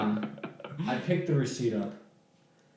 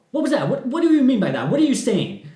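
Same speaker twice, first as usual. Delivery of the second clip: high, louder demanding questions; angry confrontation; 11 semitones higher, 8 dB louder than usual. Retold or imitated. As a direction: confront him, indignant and loud. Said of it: "stomping up to me".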